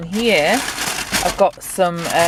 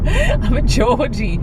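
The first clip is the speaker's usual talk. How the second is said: higher, drawn-out mock Geordie; playful Geordie accent; 6 semitones higher, slower than usual. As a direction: ham up the Geordie accent, stretching it out. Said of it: "is called Geordie".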